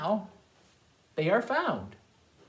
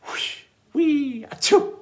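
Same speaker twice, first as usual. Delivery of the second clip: playful sound effects; 9 semitones higher, 6 dB louder, slower than usual. Acted. Sound effects of play and sneeze.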